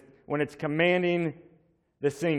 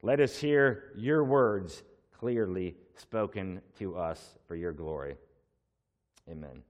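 hushed reverent amen; prayer; 4 semitones lower, 3 dB quieter than usual. Real, not acted. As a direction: pray it quietly to a close. Closing prayer before sermon.

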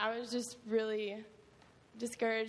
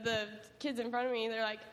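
ordinary talk

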